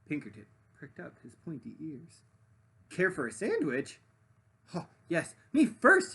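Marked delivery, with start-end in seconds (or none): 2.9-6.1 excited thought. Acted. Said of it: "he thought".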